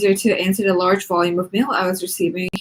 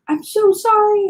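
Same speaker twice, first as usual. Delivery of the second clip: much higher startled apology; gasped apology; 13 semitones higher, 3 dB louder than usual. A narrator's reading. Nikki apologizing to the yelling teacher, gasping. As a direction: gasp it out, caught off guard. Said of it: "I gasped".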